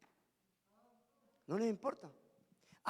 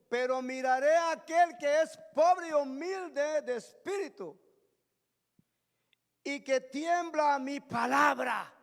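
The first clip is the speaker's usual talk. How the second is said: raised, emphatic preaching proclamation; declaimed scripture; 5 semitones higher, 8 dB louder, slower than usual. Real, not acted. Preacher reading Isaiah to the congregation, raised declamatory preaching. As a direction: proclaim the verse, stretching the final phrase.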